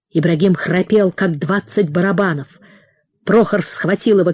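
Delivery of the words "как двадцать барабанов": plain reading voice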